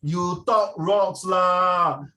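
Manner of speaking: slow, loud Singlish jibe; Singlish complaint. Acted. Teacher acting out an objection to good English, drawling Singlish. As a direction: drag it out in exaggerated Singlish.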